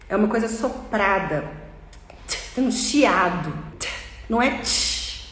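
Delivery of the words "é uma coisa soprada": ordinary talk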